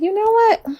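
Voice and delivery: high-pitched, loud "oh really" reaction; playful surprise at the husband's reasoning; mock surprise. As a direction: act as if suddenly won over. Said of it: "And I'm like".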